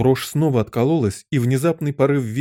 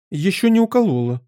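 high-pitched, agitated answer; agitated queen; 8 semitones higher, 3 dB louder than usual. A narrator's reading. Queen wailing before injury. (взволнованно, высоким голосом)

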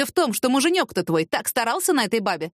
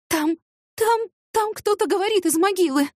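higher, halting, out-of-breath fright; stumbling frightened rush; breathless stammer; 10 semitones higher than usual. Acted. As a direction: gasp it out after running. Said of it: "сбивчиво ответила запыхавшаяся".

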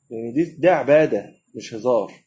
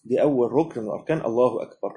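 ordinary talk, nothing marked

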